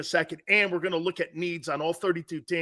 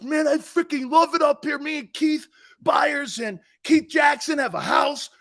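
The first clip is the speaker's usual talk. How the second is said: Jerome excited; 8 semitones higher, 4 dB louder than usual. Retold or imitated. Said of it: "he goes".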